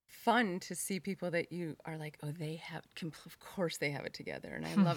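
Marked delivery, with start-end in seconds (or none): 2.2-4.5 breathy whisper, barely voiced; whispered aside. Host voicing what she assumes about other moms, whispered. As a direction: let it slip out as a secret thought.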